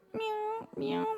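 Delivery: high falsetto kitty; falsetto meow. Shown as a demonstration. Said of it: "It's more like falsetto".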